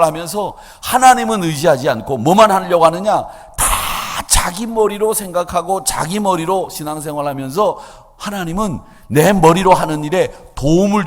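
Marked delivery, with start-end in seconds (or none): none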